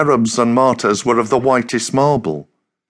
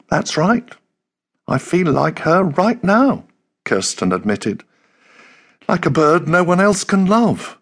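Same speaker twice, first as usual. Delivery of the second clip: put-on higher, sadder young woman's voice; lightly voiced Kirsten, sad; 7 semitones higher than usual. A narrator's reading. Kirsten comparing herself to the lonely swan, higher and sad. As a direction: let her sound a little higher and sad.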